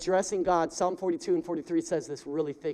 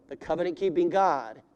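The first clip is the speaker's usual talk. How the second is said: usual speaking voice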